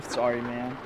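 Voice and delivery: ordinary talk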